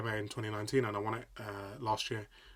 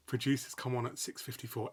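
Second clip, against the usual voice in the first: ordinary talk, nothing marked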